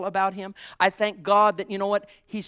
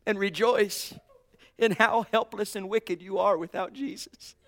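ordinary talk, nothing marked

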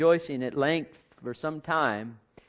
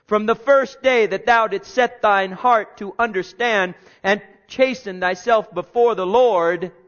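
loud, higher fervent preaching; 6 semitones higher, 10 dB louder than usual. Real, not acted. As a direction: preach it out to the congregation. Fervent recital of the verse.

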